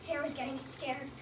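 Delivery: ordinary delivery, nothing marked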